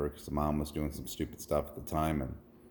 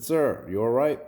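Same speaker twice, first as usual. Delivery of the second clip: higher, louder check on a stranger; concerned calling out; 6 semitones higher, 6 dB louder than usual. Retold or imitated. Driver calls to a man in a van, concerned. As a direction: call out a concerned question.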